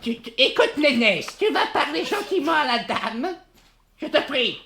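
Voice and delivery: high, laughing, mock-stern coaxing; half-laughing order to behave; coaxing scold. Sister coaxes Nénès to speak nicely to the lady, high and half-laughing. Coaxing brother onto the phone.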